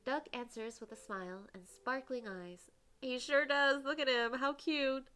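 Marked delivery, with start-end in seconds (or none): 3.0-5.1 loud, high, gushing delight. Real, not acted. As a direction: squeal over how cute he is. Gushing over cute character.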